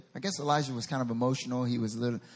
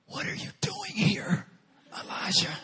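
still small voice; 2 semitones higher than usual. Acted. God confronts Elijah in the cave, intense whisper. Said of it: "said to him in a still small voice".

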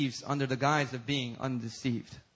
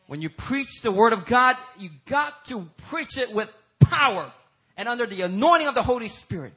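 emphatic preaching, rising higher and louder toward the end; impassioned preaching; 5 semitones higher, 7 dB louder than usual. Real, not acted. Preacher urges church to preach with power, impassioned preaching. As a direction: punch the key words and swell to a peak.

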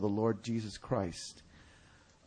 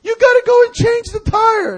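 very high, loud frantic shout; hammed-up imitation of a distressed woman; yelling for help; 17 dB louder than usual. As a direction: holler through the door in panic. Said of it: "another yell".

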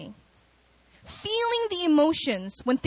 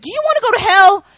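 loud, fast, higher demand; mock pastor warning; 8 semitones higher, 9 dB louder, faster than usual. Youth pastor grilling a scared child about hell, loud and fast. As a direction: fire it at the scared kid.

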